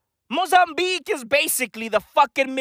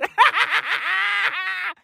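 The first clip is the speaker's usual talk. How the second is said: big burst of laughter; cackling laugh; 5 semitones higher than usual. Real, not acted. Laughs at own joke.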